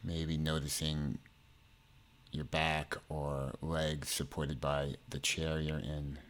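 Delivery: measured meditation delivery, phrases spaced far apart; guided meditation. Acted.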